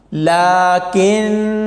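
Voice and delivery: slow, drawn-out Quranic recitation of लाकिन्ना without the long alif. Shown as a demonstration. Teacher demonstrating Quranic reading to students, slow recitation. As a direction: recite लाकिन्ना slowly, short final vowel. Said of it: "तो फिर ऐसे पढ़ा जाएगा".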